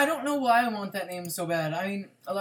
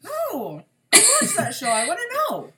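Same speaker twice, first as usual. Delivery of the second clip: high excited whine; 13 semitones higher, 4 dB louder than usual. Real, not acted. Begging to hear the spoiler.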